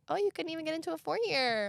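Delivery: condescending. Retold or imitated. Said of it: "They're like".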